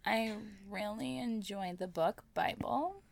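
slow, flat vocal fry; bored fry, barely inflected; Kardashian vocal fry. Pretend.

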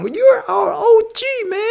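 very high, stretched, laughing gush; drawn-out voice. Retold or imitated. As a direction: drag the words out, laughing. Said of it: "那个声音就拉很".

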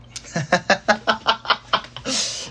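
high staccato laugh; real high-pitched chuckle. Real, not acted. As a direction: laugh in a run of quick bursts. Laughs at own cryptic remark.

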